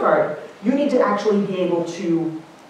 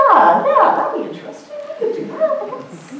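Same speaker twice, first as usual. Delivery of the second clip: hesitant agreement. Retold or imitated. People reacting to the idea of spaces, hesitant agreement. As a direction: imitate people vaguely warming to an idea, amused.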